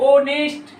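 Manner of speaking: ऑनेस्ट loud, with a long opening o; sounded-out word. Shown as a demonstration. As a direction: split ऑनेस्ट into a held o, then नेस्ट. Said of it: "ओ आ रहा है ना".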